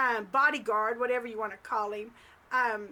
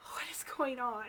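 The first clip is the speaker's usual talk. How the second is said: retold whispered disbelief; baffled whisper; 5 dB quieter than usual. Hearing Gary is guarding the premises, baffled whisper. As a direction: whisper it as a stunned private thought.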